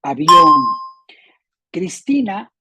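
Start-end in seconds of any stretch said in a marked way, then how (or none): 0.0-0.6 "Avión" loud, stressed on the final ÓN; stress on last syllable. Shown as a demonstration. Teacher confirming the rule, "Avión" loudly stressed on ÓN. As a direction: punch the ÓN of "Avión". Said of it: "acorde a las reglas".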